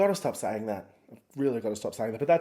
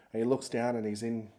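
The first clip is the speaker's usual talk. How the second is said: normal talking voice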